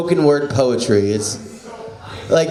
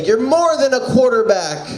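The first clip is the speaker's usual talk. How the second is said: put-on teen romance line; 7 semitones higher than usual. Shown as a demonstration. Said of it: "can you say".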